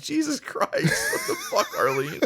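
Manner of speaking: laughing disbelief. Retold or imitated. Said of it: "I'm like".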